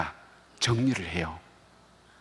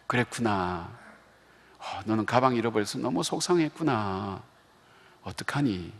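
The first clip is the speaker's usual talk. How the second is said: softer, calm comforting "그랬구나"; gentle empathy; 5 dB quieter than usual. Retold or imitated. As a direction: hold back and soothe the crying daughter. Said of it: "저도 배운 게 있잖아요". (우는 딸을 달래는 아빠, 부드럽고 차분하게)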